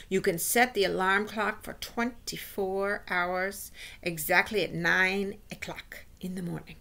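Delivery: slow, drawn-out witch lecturing; instructing witch. Acted. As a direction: spell out the recipe slowly, as a witch. Voices the Grand High Witch.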